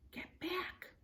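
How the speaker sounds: plain reading voice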